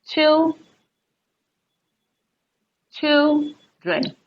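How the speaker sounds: put-on CHILdren, CHIL held long and high, dren dropped; stress on first syllable. Shown as a demonstration. Teacher showing where stress falls in children, CHIL stressed high. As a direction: punch and stretch CHIL in children, then drop. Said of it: "stress will fall on the first syllable".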